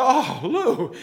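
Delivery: high, loud dismayed "Oh, Lou"; dismayed cry. Retold or imitated. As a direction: cry out his name in shock. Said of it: "my knees go a little weaker".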